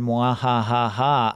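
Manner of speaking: slow evil mwahaha; mad scientist. Pretend. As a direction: cackle like a cartoon villain. Villain laugh after ransom joke.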